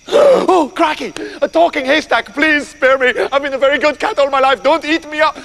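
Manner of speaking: high, startled gasp into begging; terrified storybook cat begging for its life; hammed-up fright; panicked pleading. Startled cat begs for mercy.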